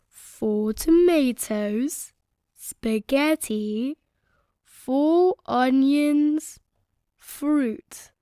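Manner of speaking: very slow, spelled-out list; slow reading. A narrator's reading. Daddy Pig reading the shopping list, very slow. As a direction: read each item carefully. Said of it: "read it out slowly".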